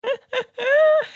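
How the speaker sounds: high, loud laugh bursting out; burst of laughter. Real, not acted. Reader laughing at her own reading, high loud laugh. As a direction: let a real laugh break out high. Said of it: "I'm laughing because it".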